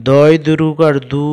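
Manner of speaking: দু pulled out long; long vowel. Shown as a demonstration. Teacher repeats the spelling for pupils, u of দু stretched. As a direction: lengthen the final u in দু. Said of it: "এখানে একটু লম্বা করে টানতে হবে".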